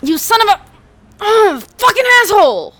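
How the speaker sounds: high, loud cursing building up; furious yelling. Acted. Young man being dragged away, yelling insults at the cop.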